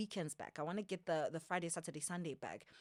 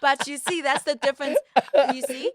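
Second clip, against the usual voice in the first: laughing; 9 semitones higher, 17 dB louder than usual. Real, not acted. Laughing at friend's joke.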